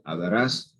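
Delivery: "Hablarás" rising onto a stressed "rás"; stress on last syllable. Shown as a demonstration. Teacher shows the future form, "Hablarás" stressed on final "rás". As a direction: put the weight at the end of "Hablarás". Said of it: "that's future".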